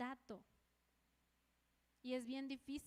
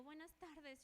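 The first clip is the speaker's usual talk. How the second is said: small, soft hello; acted timid greeting, barely voiced; meek greeting; 2 semitones higher, 10 dB quieter, slower than usual. Speaker greeting the hostile neighbour in the street, meek greeting. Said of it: "yo así".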